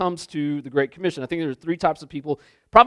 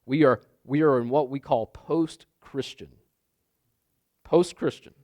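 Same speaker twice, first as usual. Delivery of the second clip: ordinary delivery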